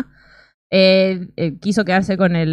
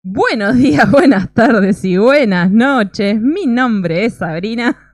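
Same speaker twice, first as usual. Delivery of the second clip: show host intro; 8 dB louder, slower than usual. Acted. Opens the podcast episode.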